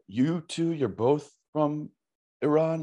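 high, slow mock questioning; high quizzical voice. In-laws asking, high put-on voice.

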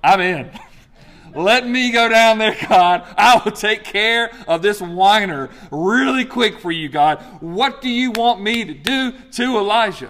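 loud, high, pumped-up; volunteer like a hyped soldier; eager volunteer angel. Pretend. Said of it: "somebody going".

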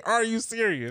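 high-pitched incredulous question; laughing disbelief, retold. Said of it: "I, I'm laughing".

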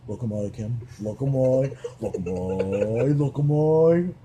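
Australian catchphrase, stretched longer each time; Australian mom. Retold or imitated. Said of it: "So I've been walking around".

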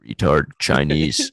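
loud, slow ranting pundit; Alex Jones impression. Comedian mocking a radio host, loud and slow. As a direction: bellow it slow like a conspiracy host. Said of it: "That was my impression of Alex Jones".

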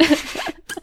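real high-pitched laughing fit. Real, not acted. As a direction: crack up laughing. Cracking up at co-host's remark.

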